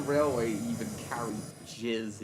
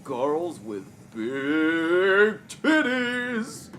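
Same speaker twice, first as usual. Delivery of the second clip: slow loud leering voice; 5 semitones higher, 9 dB louder, much slower than usual. Pretend. Joke line to chat.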